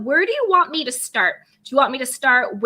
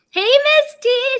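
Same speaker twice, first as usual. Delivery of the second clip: much higher, louder and slower; excited greeting; 12 semitones higher, 5 dB louder, slower than usual. Real, not acted. Host greeting a viewer on the livestream, excited. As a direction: call out with delight.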